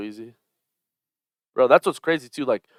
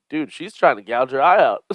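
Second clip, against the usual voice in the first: raised, loud mock outrage; alarmed commentator quote; 4 semitones higher, 8 dB louder than usual. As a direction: call out a foul in disbelief. Acting out commentators' alarmed reaction.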